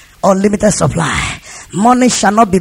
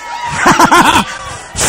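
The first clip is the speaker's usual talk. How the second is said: high burst of laughter; amused preacher's laugh; 7 semitones higher than usual. Real, not acted. Laughs at own boasting example.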